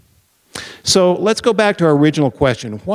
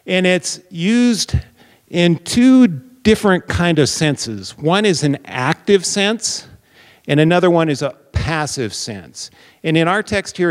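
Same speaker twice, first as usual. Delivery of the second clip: usual speaking voice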